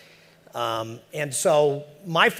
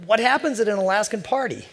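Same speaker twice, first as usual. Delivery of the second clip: eager newcomer; 9 semitones higher, 4 dB louder than usual. Retold or imitated.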